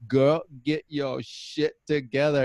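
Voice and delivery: slow, relished Texas drawl; drawn-out Texas twang. Acted. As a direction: savour each word with a Texas accent. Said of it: "fun to say it like that".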